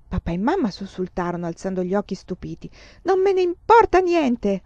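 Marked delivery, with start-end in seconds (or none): 3.0-4.6 loud, high outburst with drawn-out words; furious yell. A narrator's reading. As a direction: yell it out, fed up and defiant. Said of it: "urlò Laura". (urlo rabbioso)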